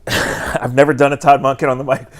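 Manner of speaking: usual speaking voice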